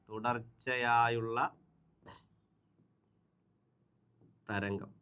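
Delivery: very slow, drawn-out and soft; low, unhurried murmur while writing; writing-out pace. Real, not acted. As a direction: stretch each word as you write it, long gap between. Writing the Malayalam term while saying it.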